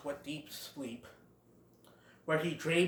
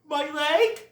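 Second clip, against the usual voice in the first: much higher, louder yelp; whining hurt dogs; 11 semitones higher, 8 dB louder, much slower than usual. Retold or imitated. Dogs kicked by the Great Prince, mock yelping. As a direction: cry out in mock pain. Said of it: "have them going".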